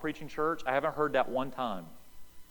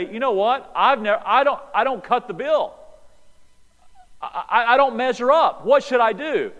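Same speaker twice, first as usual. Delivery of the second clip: higher and louder confession; imagined sinner's plea, raised; repentant man; 7 semitones higher, 8 dB louder than usual. Retold or imitated. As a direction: pour it out like a man who knows he failed. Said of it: "what Jesus is trying to get this guy to do is say".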